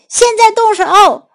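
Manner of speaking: high, loud shouted command; urgent call, pitched high and loud. A narrator's reading. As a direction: shout the order to act. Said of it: "泰迪大喊起来".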